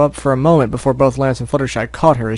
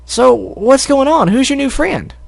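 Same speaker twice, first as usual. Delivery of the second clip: high slow curious filly; Apple Bloom voice; 12 semitones higher, slower than usual. Acted. Apple Bloom asking about the new friend, high voice. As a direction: ask eagerly as a filly.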